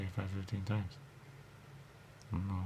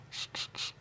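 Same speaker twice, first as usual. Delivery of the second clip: radio static; 6 dB quieter than usual. Retold or imitated.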